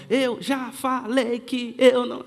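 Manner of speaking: slow, chopped-up imitation of a scolding mother; syllable by syllable. Mother beating her son as she scolds, syllable by syllable. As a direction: hammer out each syllable separately. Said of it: "Ela batia por sílaba".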